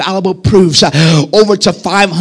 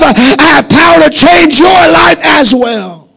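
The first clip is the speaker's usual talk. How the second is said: rising, ringing sermon voice, climbing higher; preaching at full force; 4 semitones higher than usual. Real, not acted. Preacher proclaiming resurrection to his online congregation, full-force preaching. Preacher quotes the risen Jesus.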